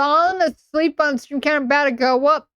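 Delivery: ordinary delivery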